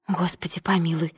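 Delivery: unvoiced, soft aside; whisper, lips only. A narrator's reading. Maid reacting to herself so the girl won't hear, whispered. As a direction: whisper it almost soundlessly to yourself. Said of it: "одними губами произнесла Нэнси".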